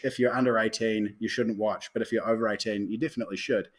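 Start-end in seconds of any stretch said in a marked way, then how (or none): none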